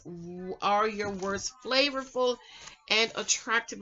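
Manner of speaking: normal talking voice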